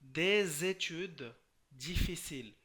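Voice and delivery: des études said slowly as "dezétudes" with a linking z; liaison demo. Shown as a demonstration. Teacher slowing down to show Bengali students the French liaison. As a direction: link des to études with a z sound. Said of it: "আরও রয়েছে যেমন ce sont".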